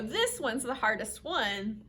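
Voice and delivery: usual speaking voice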